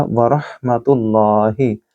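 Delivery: रहमतुल्लाहि with a throaty ह, slowly; slow phrase, throaty ह. Shown as a demonstration. Teacher repeating the phrase, throaty h. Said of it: "और हा को गले से बोलिएगा".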